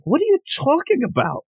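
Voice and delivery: high-pitched baffled protest; incredulous. Retold or imitated. Crowd answers the slapping boy, high-pitched incredulity. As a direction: squeal it in mock disbelief.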